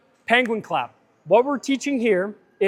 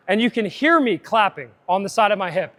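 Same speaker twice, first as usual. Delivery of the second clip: usual speaking voice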